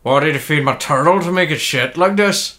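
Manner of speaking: put-on Northern Irish voice; Northern Irish impression at everyday pitch; Northern Irish accent. Shown as a demonstration. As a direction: say it as if from Northern Ireland. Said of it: "Northern Irish".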